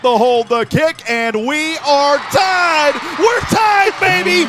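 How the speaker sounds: announcer erupting as the game is tied; excited call. Real, not acted. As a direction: let it explode as the kick goes through. Game-tying kick call.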